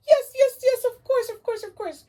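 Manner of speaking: very high, loud, gushing owl; excited puppet owl. Acted. Owl puppet thrilled about the story, squealing. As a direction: squeal with joy over story time.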